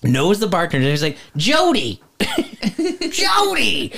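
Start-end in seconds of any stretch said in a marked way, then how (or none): 1.4-2.0 put-on high-pitched Tennessee boy calling out; mock kid yell. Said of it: "He yells at the bartender". 3.1-3.9 very high imitation of a Tennessee boy hollering; mock kid yell. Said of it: "He yells at the bartender".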